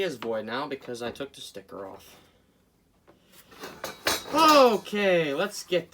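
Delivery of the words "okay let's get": much higher, loud shouted okay; sudden shout; yelling. Real, not acted. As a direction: belt it out for no reason. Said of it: "Why am I yelling?".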